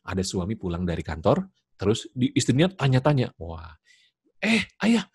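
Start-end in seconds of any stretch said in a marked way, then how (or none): 4.4-5.0 high-pitched wife calling her husband; imitating a talkative wife, pitched well up; chatty wife. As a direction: gush out the day's news to Ayah. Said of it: "wah ngomongnya banyak".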